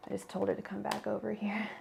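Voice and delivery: hushed whisper to the side; a quick secret aside. Real, not acted. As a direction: whisper it so she won't hear. Aside to audience off-mic.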